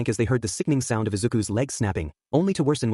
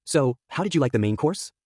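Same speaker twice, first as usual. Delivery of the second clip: ordinary delivery, nothing marked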